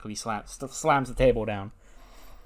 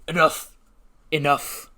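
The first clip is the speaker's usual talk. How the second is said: raised, forceful wrestler's call; commanding interruption; 5 semitones higher, 5 dB louder, slower than usual. Acted. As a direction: cut in hard, as if silencing a crowd. Wrestler breaks in with a promo.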